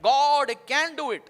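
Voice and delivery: drawn-out declaration of faith; slow proclamation. Acted. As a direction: proclaim it slowly, with weight. Preacher proclaiming slowly.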